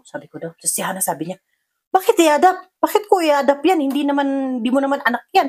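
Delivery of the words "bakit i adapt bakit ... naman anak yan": loud high protesting retort; indignant objection. Retold or imitated. Daughter Hana refusing to adopt the child, loud indignant protest. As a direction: push back hard, offended.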